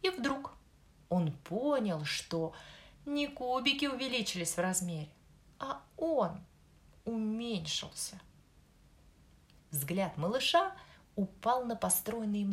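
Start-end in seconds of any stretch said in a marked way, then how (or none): none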